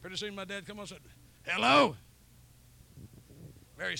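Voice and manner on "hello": loud, higher phone hello. Retold or imitated. Dad picking up the phone with a loud hello. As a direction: bark a gruff hello into the phone.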